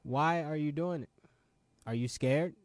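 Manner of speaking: normal talking voice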